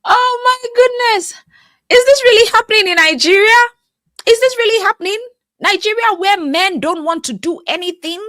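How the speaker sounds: stunned at the news; shocked disbelief. Real, not acted. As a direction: gasp it out in disbelief.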